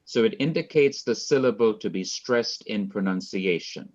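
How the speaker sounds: ordinary talk, nothing marked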